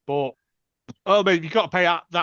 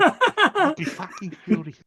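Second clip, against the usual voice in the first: higher, slow New Zealand accent; 9 semitones higher than usual. Shown as a demonstration. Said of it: "But in a New Zealand accent".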